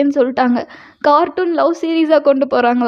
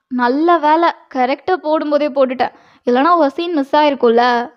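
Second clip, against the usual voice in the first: plain reading voice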